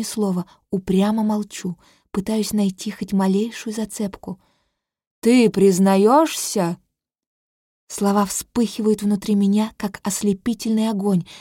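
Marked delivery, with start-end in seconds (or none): 5.2-6.8 slow, loud demanding elder; stern old sage. Acted. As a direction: press for a confession, slowly. Elder presses the question. (строгий старец, медленно и громко)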